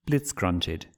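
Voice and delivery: usual speaking voice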